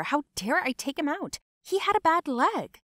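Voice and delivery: mounting indignant outburst; indignant yell. A narrator's reading. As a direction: yell in outrage, pitch climbing to the end. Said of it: "She yelled what he was doing here".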